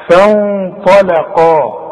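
فانطلقا slowly with the ن hidden and nasal before ط; slow recitation. Shown as a demonstration. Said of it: "من حروف الإخفاء".

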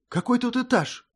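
high, astonished question. Acted. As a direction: gasp out the question in disbelief. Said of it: "вслух изумилась Мира".

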